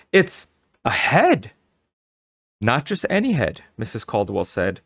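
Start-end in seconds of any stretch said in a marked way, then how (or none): 0.1-1.5 slow, high-pitched astonishment; surprised cry. A narrator's reading. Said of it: "cried out in surprise". 2.6-3.6 higher-pitched proud correction; beaming pride. A narrator's reading.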